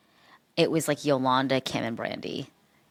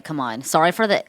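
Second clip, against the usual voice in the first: usual speaking voice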